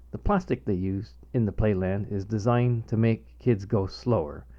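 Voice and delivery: ordinary delivery, nothing marked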